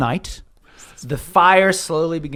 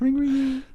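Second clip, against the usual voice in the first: high, quick ring ring ring like a tinny bell; sing-song imitation of a ringing bell; 9 semitones higher, 5 dB quieter than usual. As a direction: chime it out high like a doorbell. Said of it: "one of the bells begins to ring".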